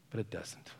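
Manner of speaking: whispered, gentle assurance; hushed reassurance. Real, not acted. Preacher quietly reassuring the worried congregation. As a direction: breathe it as comfort.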